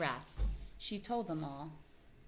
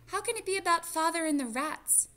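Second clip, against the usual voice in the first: put-on much higher, louder little girl voice; a puzzled young mouse asking; puzzled child voice; 12 semitones higher, 7 dB louder than usual. Teresa asks her mother, puzzled, in a little girl voice.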